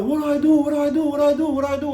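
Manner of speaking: high, loud, frantic repeats; pleading in panic, rising pitch. Retold or imitated. Husband reliving the panic of delivering his baby, high frantic cries. Retold panic during birth.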